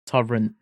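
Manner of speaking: "torrent" with a light T closing it; low, quick "torrent" ending on a T; final T sounded. Shown as a demonstration. Showing a friend the difference, final T sounded. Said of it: "the end of torrent with a T".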